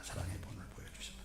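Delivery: 사랑의 본을 보여주셨다 whispered. Shown as a demonstration. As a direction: echo it as a whisper. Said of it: "속삭여 반복". (속삭여 되풀이하는 대답)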